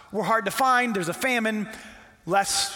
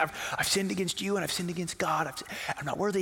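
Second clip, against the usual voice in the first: soft practice run; rehearsing an apology; 4 semitones lower, 6 dB quieter than usual. Acted. Son practicing his apology on the way home, rehearsing softly. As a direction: run it over quietly to yourself. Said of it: "and he's rehearsing it".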